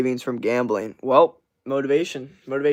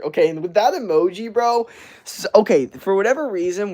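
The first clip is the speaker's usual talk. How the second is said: higher, louder incredulous outburst; 7 semitones higher, 7 dB louder than usual. Real, not acted. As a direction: react to the silly emoji in disbelief. Reacting to a chat emoji.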